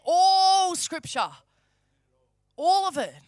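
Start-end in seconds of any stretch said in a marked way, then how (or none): 0.0-1.3 "all" drawn out long, high and loud; emphatic ALL. Real, not acted. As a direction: stretch "all" right out, louder and higher. Preacher insists nothing be left out.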